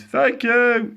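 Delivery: loud, high shouted thanks; calling back. A narrator's reading. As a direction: call it back down to a crowd. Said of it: "he called back".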